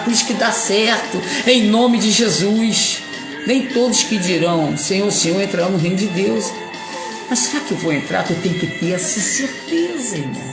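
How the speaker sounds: normal talking voice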